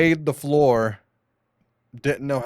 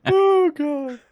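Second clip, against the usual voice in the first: laughing; 5 dB louder than usual. Real, not acted. Laughing high squeal.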